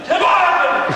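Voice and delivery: high shouted warning; mock yell. Skier yells a warning coming down the mountain, high. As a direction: holler it down the mountain. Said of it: "came down yelling".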